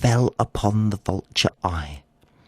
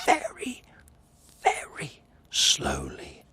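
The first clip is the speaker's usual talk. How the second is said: whisper; 6 semitones lower, 8 dB quieter than usual. Acted. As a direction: stretch it out in a hush. Moving his head in slowly.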